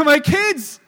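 loud mocking parental pride; mock proud parent. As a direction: parody a boasting parent. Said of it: "You know".

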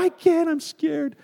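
put-on high, slow small-boy whimper; a scared kid pleading with mom; scared little boy. Child voiced in story.

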